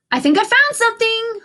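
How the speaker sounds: high, loud call; hollered. A narrator's reading. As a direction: call out to the friends. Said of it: "hollered Cindy".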